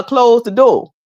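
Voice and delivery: mock Gullah Geechee "Close the door"; Geechee accent. Teasing a Charleston viewer in a playful Geechee accent. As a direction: tease with a Geechee twang. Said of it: "Where your Geechee accent at?".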